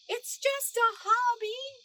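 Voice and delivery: high, slow squeaky mouse shout; a little mouse pleading loudly. A narrator's reading. Norman caught by the tail, squeaky loud shout. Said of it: "shouted Norman".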